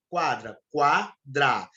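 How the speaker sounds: quadra drawn out slowly, twice; slow teacher demo of quadra; slow spelled-out word. Shown as a demonstration. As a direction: say quadra slowly, stretching the qua glide. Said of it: "Veja que eu tenho duas vogais".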